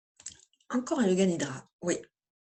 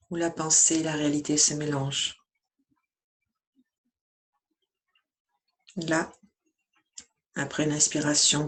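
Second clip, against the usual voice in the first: even, measured meditation voice with long pauses; guided meditation. Acted. Yoga teacher guiding listeners lying down through yoga nidra, calm meditation voice.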